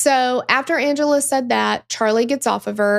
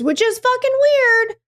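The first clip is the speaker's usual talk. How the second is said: high, slow, baffled drawl; high incredulous aside; 12 semitones higher, 3 dB louder than usual. Retold or imitated. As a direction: stretch it out in mock confusion. Voicing the sister's confusion.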